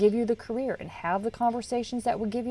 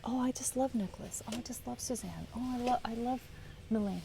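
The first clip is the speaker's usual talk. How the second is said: breathy mock-adoring whisper, much softer; gushing whisper; 2 semitones higher, 9 dB quieter than usual. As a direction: whisper the fan's gushing like a secret crush. Fans gushing about favourite crew.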